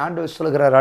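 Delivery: usual speaking voice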